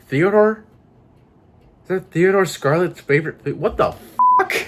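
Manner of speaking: high, incredulous realization; shocked disbelief. Real, not acted. Player works out who Teddy is, shocked. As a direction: voice jumps up in dawning disbelief.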